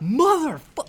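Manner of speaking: high, loud imitated outburst, laughing; loud comic burst of swearing; mock cussing out. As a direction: imitate someone going off, cut short. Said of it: "this lady was cussing him out".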